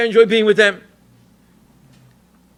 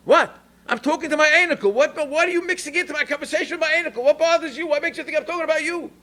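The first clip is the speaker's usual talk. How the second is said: grandmother's voice; 9 semitones higher than usual. Retold or imitated.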